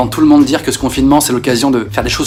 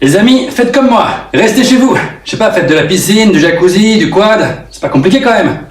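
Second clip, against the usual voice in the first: rich celebrity voice; 5 semitones higher than usual. Acted. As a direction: play a smug rich celebrity. Rich star in mansion advises. (star riche et condescendante)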